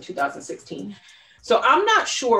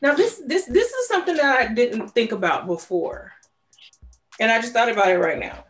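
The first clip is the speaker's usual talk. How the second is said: excited realization; 5 semitones higher, 6 dB louder than usual. Real, not acted. Sudden realization mid-story.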